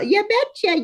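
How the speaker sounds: mock Minnesota "betcha"; a light Fargo-style "Yeah, betcha"; Fargo accent. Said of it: "sound like the movie Fargo".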